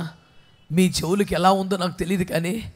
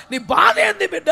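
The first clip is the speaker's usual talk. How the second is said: mother asking; 10 semitones higher than usual. Retold or imitated. Mother's voice in sermon.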